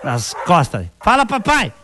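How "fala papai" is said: commanding the dog. Acted.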